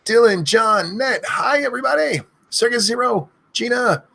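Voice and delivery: high yelled string of names; screaming names. Acted. Streamer screaming viewers' names in a row, yelling. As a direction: fire off each name as a yell. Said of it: "I end up screaming too much".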